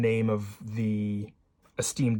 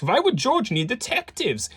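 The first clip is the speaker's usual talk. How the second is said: put-on high-pitched, incredulous Viennese-accented professor; 12 semitones higher, 4 dB louder than usual. Professor questioning the detectives' presence, high Viennese accent. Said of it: "shall I do a Viennese as-, accent".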